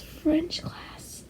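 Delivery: soft conspiratorial whisper. Real, not acted. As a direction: breathe it out as a secret. Sudden whispered aside.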